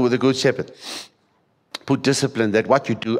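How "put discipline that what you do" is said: ordinary talk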